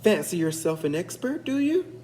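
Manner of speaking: mocking taunt, pitch jumping up at the end; scornful challenge. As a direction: needle him with a sneer. Leprechaun taunts Finn.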